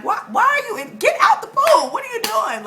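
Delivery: high, mock-outraged shout at the end; acted exasperation. Scolding whales in a pool in her dream, acted exasperation. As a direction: shoo them out, voice jumping way up.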